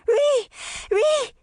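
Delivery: put-on very high toddler squeal of a shout; excited baby voice. A narrator's reading. Toddler pointing at something in the water, excited baby shout. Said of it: "shouting excitedly in her baby voice".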